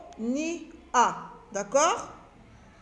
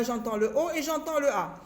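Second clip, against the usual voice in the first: ordinary talk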